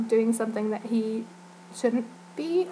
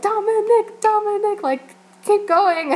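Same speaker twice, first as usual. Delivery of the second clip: high, loud chant of his name; cheering him on; 10 semitones higher, 5 dB louder, slower than usual. Retold or imitated. Fans urging Dominic to keep dancing, high cheering call. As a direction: egg him on from the crowd, begging for more.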